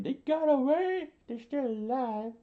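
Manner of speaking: very high, loud falsetto shriek; piercing crowd-style scream; shocked screaming. Acted. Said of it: "everyone screamed".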